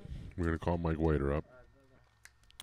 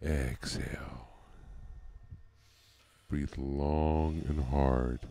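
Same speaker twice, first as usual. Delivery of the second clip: slow drawn-out breathing instructions; breathing coach; 4 semitones lower, much slower than usual. Shown as a demonstration. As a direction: pace him through each breath slowly. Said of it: "coaches breathing exercise".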